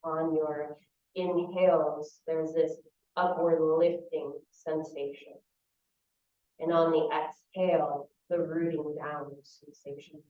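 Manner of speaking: calm visualization in short, spaced phrases; unhurried meditation teacher, phrase by phrase; guided meditation. Real, not acted. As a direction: guide them through the image, leaving long silences.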